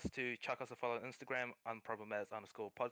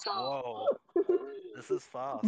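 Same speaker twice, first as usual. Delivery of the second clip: mock surprise; 10 dB louder, slower than usual. Teasing about a second date.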